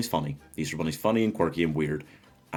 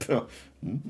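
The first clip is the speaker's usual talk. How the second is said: mock sarky scoff; 4 semitones higher than usual. Imitating Jack being sarky about things, scoffing. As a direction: puff it out dismissively. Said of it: "a little bit sarky about things".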